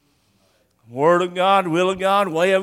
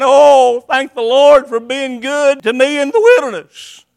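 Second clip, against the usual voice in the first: grateful praise; 6 semitones higher, 6 dB louder than usual. Retold or imitated. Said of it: "And he goes saying".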